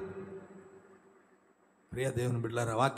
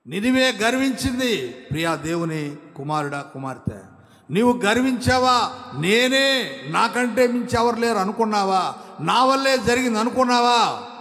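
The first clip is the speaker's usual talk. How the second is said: higher and louder, challenging each question; full-force preaching; 7 semitones higher, 6 dB louder than usual. Acted. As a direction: call the listener out on their pride. Confronting listeners with pride. (శ్రోతలను గద్దిస్తూ బోధన)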